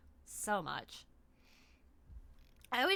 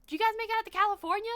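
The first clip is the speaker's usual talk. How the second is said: fast, high and louder aunt imitation; mock chatty aunt; 12 semitones higher, 7 dB louder, much faster than usual. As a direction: rattle it off like the kooky aunt. Aunt's line retold.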